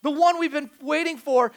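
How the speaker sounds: loud, high proclamation; emphatic, emotional. Retold or imitated. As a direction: punch it out as an excited announcement. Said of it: "he's emphatic, emotional".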